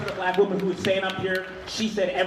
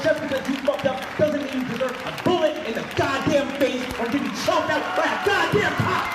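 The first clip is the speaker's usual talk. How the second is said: angry shout; 9 semitones higher, 5 dB louder than usual. Real, not acted. Protest speech about police killings.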